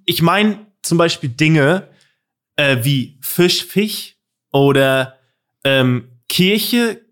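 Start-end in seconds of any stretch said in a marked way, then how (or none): 3.6-4.2 Fisch said as "Fich", soft ch for sch; mispronounced Fisch. Shown as a demonstration. Podcaster listing words people get wrong, mispronounced Fisch. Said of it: "falsch aussprechen oder betonen". 6.3-7.0 Kirche as a drawn-out example word, "Kiche"; mispronunciation example. Shown as a demonstration. Podcaster giving examples of words said wrong, mispronunciation demo. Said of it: "falsch aussprechen oder betonen".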